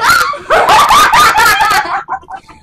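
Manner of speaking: helium laugh; high shrieking laugh. Real, not acted. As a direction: squeal with laughter.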